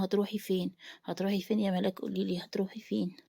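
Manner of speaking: plain reading voice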